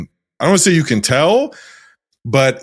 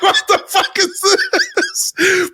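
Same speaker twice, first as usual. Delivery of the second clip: laughing disbelief; much slower than usual. Retold or imitated. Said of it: "It's like".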